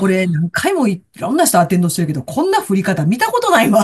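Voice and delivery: baffled retort in Kansai intonation; Kansai dialect. Retold or imitated. Partner exclaiming at freak sideways rain, in Kansai dialect. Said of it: "今、ちょっと関西弁でしたけど".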